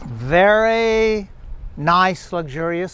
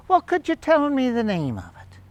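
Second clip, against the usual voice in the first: put-on British accent; 7 semitones higher than usual. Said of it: "delightful British accent".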